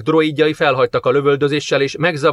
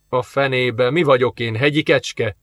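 ordinary delivery, nothing marked

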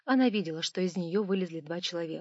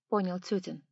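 plain reading voice

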